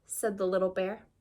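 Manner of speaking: ordinary delivery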